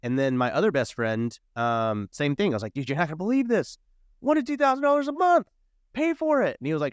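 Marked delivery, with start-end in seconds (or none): 2.7-3.8 high, eager retelling of the pitch; excited pitch. Retold or imitated. Telling his best friend about the money, high and excited. 4.2-5.5 much higher, hyped-up figure; excited. Retold or imitated. Pitching his best friend on the monthly income, excited. 5.9-6.5 high, eager retelling of the pitch; excited pitch. Retold or imitated. Telling his best friend about the money, high and excited.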